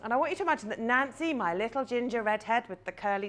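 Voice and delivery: ordinary talk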